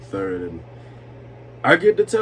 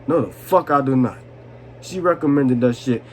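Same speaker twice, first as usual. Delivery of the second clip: ordinary talk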